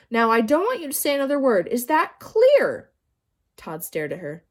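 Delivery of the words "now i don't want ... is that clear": stern warning. A narrator's reading. Teacher warning Todd to stay silent. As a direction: drive the warning home.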